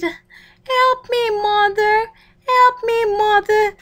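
high, loud desperate cry; crying for help. Acted. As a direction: scream for mother, panicked. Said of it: "Millipede then cried".

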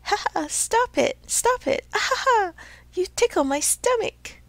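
high, loud giggles through the words; a tiny ticklish voice laughing out pleas; giggling tiny voice. Acted. The log giggles as the carpenter planes it, high and giggling. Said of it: "This time it giggled as it spoke".

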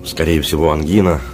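ordinary talk

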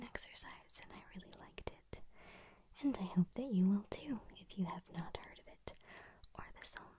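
gentle breathy whisper. Real, not acted. ASMR host whispering an intro to her viewers. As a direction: keep it all in a hush.

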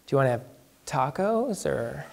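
soft, hesitant imitation of a friend; tentative suggestion. Friend inviting him to lunch, fishing for his race, tentatively mimicked. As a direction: float it quietly with long, careful pauses.

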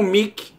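"Mickey" said "Mii-ki", with an ee vowel; Portuguese spelling reading. Shown as a demonstration. Teacher showing the wrong reading of an English name, ee vowel. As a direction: read "Mickey" with a plain i, as spelt. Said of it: "Você não pode ler isso aqui como".

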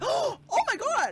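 very high, slow shocked cry; shocked gasp. Real, not acted. Streamer sees the roof catch fire, shocked cry.

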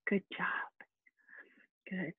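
soft whispered good job; whispered praise. Real, not acted. Teacher praising students in a whisper.

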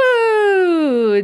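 high, loud gasp then a swooning ooh; excited gasp and ooh; delighted gasp. Real, not acted. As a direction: gasp as if you just saw someone cute. Spots Jesse, reacts.

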